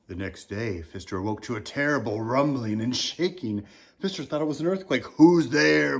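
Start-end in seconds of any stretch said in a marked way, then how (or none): 5.2-6.0 big booming giant shout. Acted. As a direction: boom it out like a giant. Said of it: "boomed a bellowing voice".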